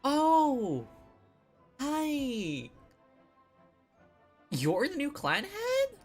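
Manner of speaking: ordinary talk, nothing marked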